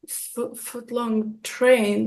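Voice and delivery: soft, slow, puzzled repeat; baffled echo. Retold or imitated. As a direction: stammer it back slowly, not getting it. Baffled echo of his offer.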